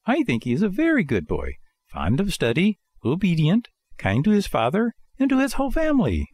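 high puppet voice listing virtues; self-praising puppet Pinocchio. Acted. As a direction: sing your own praises in a high voice. Pinocchio praises himself, lying.

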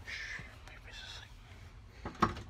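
soft slow shushing whisper; whispering over a crib. Real, not acted. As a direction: hush it, barely audible. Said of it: "I gotta be real quiet".

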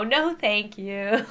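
slow, drawn-out dismissive refusal; slow no thank you. Acted. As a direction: draw it out with a rising end. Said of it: "Scary TikToks that make you go".

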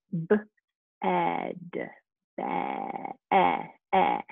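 B-ed broken into pieces, eh eh isolated; segmenting sounds. Shown as a demonstration. Teacher segmenting bed into sounds. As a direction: sound out the short e. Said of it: "We have a picture of a".

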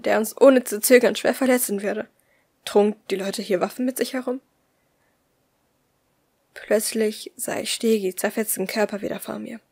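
usual speaking voice

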